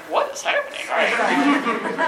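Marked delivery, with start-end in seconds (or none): 0.1-0.8 giggly disbelief. Retold or imitated. Onlooker baffled by her crying.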